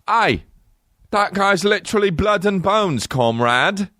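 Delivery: high, exaggerated British voice; mock British accent. British actor as a Russian reporting a casualty, mock British accent. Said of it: "literally British".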